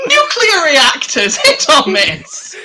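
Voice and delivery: slow, loud, laughing game-show call; laughing announcement. Acted. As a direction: announce it slowly while cracking up. Announcing the segment through laughter.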